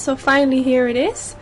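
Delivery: normal talking voice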